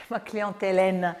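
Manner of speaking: ordinary talk, nothing marked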